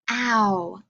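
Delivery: "ow" as in mouse, one clear glide; keyword sound demo. Shown as a demonstration. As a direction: model the "ow" sound for the class. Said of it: "So we have mouse".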